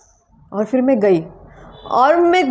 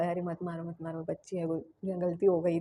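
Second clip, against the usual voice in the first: soft plea; 5 semitones lower, 17 dB quieter than usual. Retold or imitated. Teacher's plea to stop hitting.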